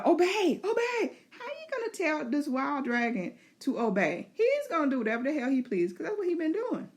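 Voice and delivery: high, laughing, desperate commands; high laughing plea of obey; pleading rider. Pretend. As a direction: rider begging the wild dragon to obey. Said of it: "And Reyna's like".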